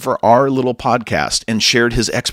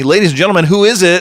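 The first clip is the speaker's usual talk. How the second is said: hammed-up announcer build-up; 8 semitones higher, 6 dB louder, faster than usual. Host hypes up a surprise guest.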